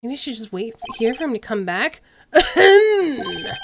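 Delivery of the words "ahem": loud, showy ahem; pompous hammed-up ahem; over-the-top throat clearing. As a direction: clear your throat grandly for attention. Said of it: "most over-the-top clearing of the throat".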